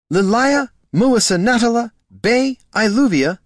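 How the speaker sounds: high, loud fussy elf calling a long name; a flustered agent reeling off her full name; excitable elf agent. Acted. Agent Leary scolding Catha by full name, high and loud.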